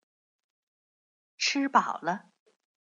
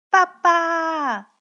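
put-on high, loud child's call for daddy; 8 semitones higher, 7 dB louder than usual. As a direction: call to daddy as a little one. Child calls out to dad.

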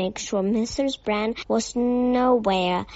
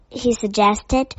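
plain reading voice

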